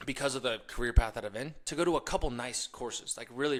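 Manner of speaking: usual speaking voice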